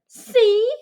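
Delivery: loud high exclamation; girl's loud vindication; triumphant see. Acted. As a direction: point it out triumphantly. Mia vindicated.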